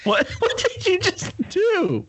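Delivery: laughing, slow what did you; laughing question. Real, not acted. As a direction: ask while cracking up. Laughing at his yelp.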